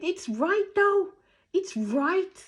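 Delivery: high, loud, drawn-out protest; high-pitched, exasperated insistence; emphatic retort. Retold or imitated. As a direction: insist at the top of your voice, stretching the words.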